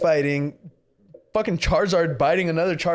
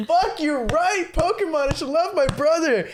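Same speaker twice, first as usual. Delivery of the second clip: high, mock-emotional outburst; overcome kid; 13 semitones higher than usual. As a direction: blurt it out as a deeply moved child. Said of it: "when you're a kid and you're just feeling that".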